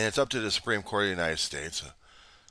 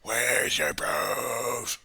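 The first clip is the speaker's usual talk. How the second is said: very slow, stretched-out demand; mock yelling demand; 3 semitones higher, much slower than usual. Arguer demanding proof in your face, drawn-out taunt. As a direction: drag out every word as a taunt. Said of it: "keep yelling and screaming at you saying".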